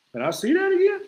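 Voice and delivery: high yelping disbelief; Cat Williams impression. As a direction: squeal it like Cat Williams in shock. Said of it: "I'm like Cat Williams".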